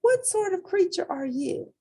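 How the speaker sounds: put-on high, small child voice for Portly; squeaky polite kid; little hippo voice. A narrator's reading. Portly questions the animal.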